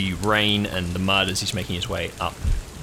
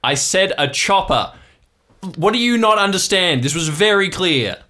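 loud, raised, indignant dad; exasperated background yelling; 11 semitones higher, 8 dB louder than usual. Acted. Dad in the background of the call, loudly exasperated. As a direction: berate them from across the boat. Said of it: "You can hear your dad in the background going".

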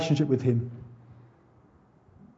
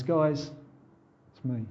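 gentle; 6 dB quieter, slower than usual. Pretend. Jesus greeting fishermen gently.

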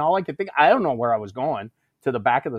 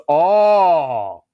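loud, drawn-out "oh"; long, exaggerated dawning understanding; mock realization; 5 semitones higher, 9 dB louder than usual. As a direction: imitate a sergeant catching on. Senior reacts to the explanation.

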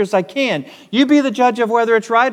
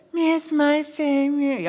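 higher, soft whiny sulk; whining; 5 semitones higher, 4 dB quieter than usual. Acted. Pastor voicing a sulking king, whining softly. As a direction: whimper it like a sulking husband. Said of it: "you can almost hear the whining in this".

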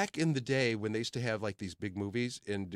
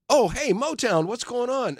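much higher, louder friendly hail; playful high-pitched impression of a star; imitating a cheery star greeting; 13 semitones higher, 8 dB louder, slower than usual. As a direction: greet him like an old pal, high and bright. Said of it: "Then he goes".

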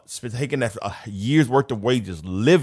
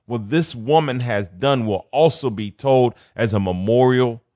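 higher, louder fervent preaching; preaching build; 3 semitones higher, 3 dB louder than usual. Acted. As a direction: proclaim it with rising fire. Reads scripture with rising fervour.

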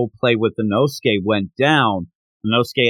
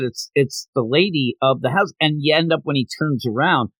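ordinary delivery

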